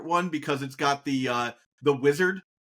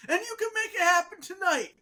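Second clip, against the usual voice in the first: imitated rousing speech, pitched way up; impassioned movie speech; 14 semitones higher than usual. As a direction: mimic a climactic movie plea, very high. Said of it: "he does that speech where he's like".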